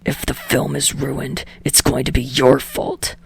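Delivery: snarled. Acted. Bakugo answering Deku's call, low snarl.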